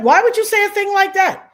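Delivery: high, loud, faked shock; mock shocked coworker. Streamer imitating the shocked coworker, high and loud. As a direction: play the innocent gasp, high. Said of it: "She was like".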